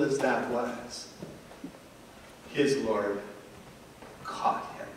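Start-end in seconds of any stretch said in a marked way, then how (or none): 2.5-4.9 whispered. Real, not acted. Preacher ends the story to the congregation in a whisper. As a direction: whisper it, much softer.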